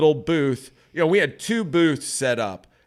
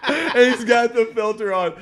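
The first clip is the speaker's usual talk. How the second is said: high, loud words through laughter; real laughing; 11 semitones higher, 6 dB louder than usual. Real, not acted. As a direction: gasp the line out while cracking up. Still cracking up while telling.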